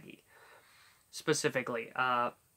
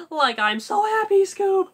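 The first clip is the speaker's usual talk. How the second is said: high giggly cheerful Shaggy; happy Shaggy; 13 semitones higher, 4 dB louder than usual. Shown as a demonstration. Shaggy telling Scooby he is happy, high giggly voice. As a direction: beam at Scoob. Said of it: "Or it could be".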